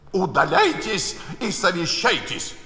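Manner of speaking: the King's voice wavering; mild tremor of fear; trembling voice. A narrator's reading. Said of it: "дрогнувшим голосом".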